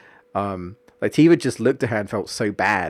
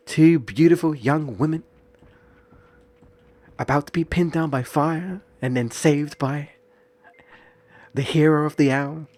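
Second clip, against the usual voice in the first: higher, theatrical actress narrating her own rescue; dramatic Jessie; 5 semitones higher than usual. Retold or imitated. Jessie casting herself as a heroine, higher and theatrical. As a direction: play it like a stage diva. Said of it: "She's like".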